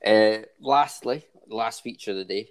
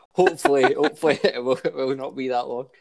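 laughing; 5 semitones higher than usual. Real, not acted. Laughing at own joke.

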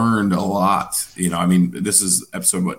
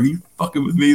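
retold disbelief, pitched higher; incredulous; 7 semitones higher, faster than usual. Podcaster recalling the surprise call at the gym, incredulous. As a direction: play up the disbelief a little. Retelling his disbelief at the news.

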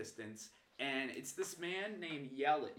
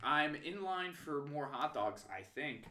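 ordinary delivery

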